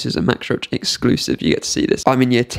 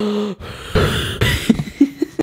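sharp high gasp for air; imitating a breathless gasp; gasping for breath; 8 semitones higher than usual. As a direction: gasp like you've run out of air. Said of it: "if you see me get out of breath".

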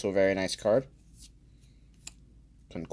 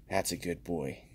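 softer, lower cooing praise; soft pet praise; 5 semitones lower, 9 dB quieter than usual. Pretend. Praising the card like a dog, softer and lower. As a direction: coo it like to a dog.

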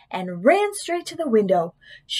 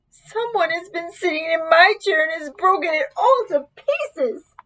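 tiny, high-pitched bear cub; wee little Baby Bear voice; 7 semitones higher than usual. A narrator's reading. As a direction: squeak it like an upset little cub. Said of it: "cried in his wee little voice".

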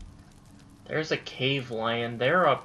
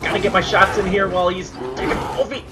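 startled loud yelling; yelling at a bear; 6 semitones higher, 9 dB louder than usual. Real, not acted. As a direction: shout in sudden alarm. Sudden bear attack in fight.

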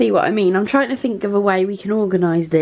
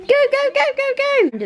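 much higher quick-fire go, go, go; urgent go go go. Real, not acted. Mum rushing the kids out, high and urgent. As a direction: push everyone out the door.

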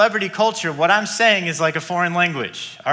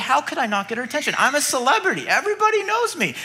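fast, higher, self-important whine; indignant celebrity; 8 semitones higher, faster than usual. Retold or imitated. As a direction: sound baffled that anyone could ignore a star. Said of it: "He's like".

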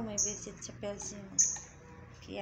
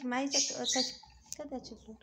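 ordinary delivery

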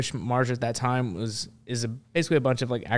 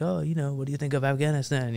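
higher-pitched French reporter; lightly put-on French accent; 4 semitones higher than usual. French reporter asking soldiers about Afghanistan, in a French accent. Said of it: "I can do a French accent".

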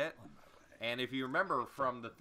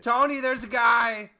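excited alarm; 10 semitones higher, 14 dB louder than usual. Pretend.

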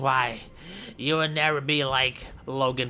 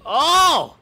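much higher, louder cry; surprised shout; 13 semitones higher, 9 dB louder than usual. Pretend. Commentator crying out at a sudden move. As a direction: gasp out a loud oh.

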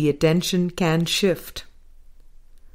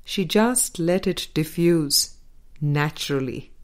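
usual speaking voice

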